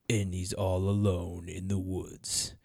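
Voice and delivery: low, slow, drawn-out spooky narration; deep scary-story voice. Retold or imitated. Quoting the co-host's scary woods story, deep and slow. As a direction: imitate a slowed-down deep storyteller. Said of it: "tried to get my voice as deep as possible".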